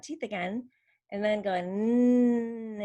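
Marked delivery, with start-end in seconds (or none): 1.5-2.8 "mm" said as a long "nnn"; N sound. Shown as a demonstration. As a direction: hum N with tongue tip behind the top teeth. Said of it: "stick your tongue tip up".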